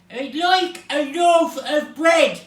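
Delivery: slow, high-pitched booming shout in Irish. A narrator's reading. Mister Noisy ordering in the baker's shop, booming. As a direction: boom it out, loud and drawn out. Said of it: "boomed Mister Noisy".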